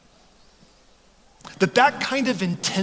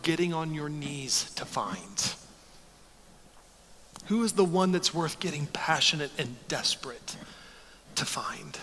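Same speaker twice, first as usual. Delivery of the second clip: soft appeal; 3 semitones lower, 7 dB quieter than usual. Real, not acted. Quiet appeal to congregation.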